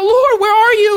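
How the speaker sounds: high, loud plea to God; anxious believer crying out to God. Retold or imitated. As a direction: call out desperately upward. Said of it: "and I'm crying out".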